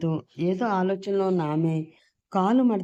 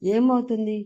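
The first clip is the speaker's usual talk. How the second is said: a sharp, raised angry question, higher and a bit louder; 5 semitones higher, 3 dB louder than usual. A narrator's reading. Husband snaps at his wife refusing help, angrily. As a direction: snap the question back at her. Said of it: "కోపంగా అన్నాడు అతను".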